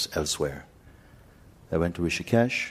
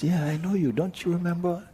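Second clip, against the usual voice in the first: high, eager insisting; pushy friendly stranger, pitched high; insistent stranger; 13 semitones higher than usual. Retold or imitated. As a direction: insist brightly you know him. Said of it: "He says".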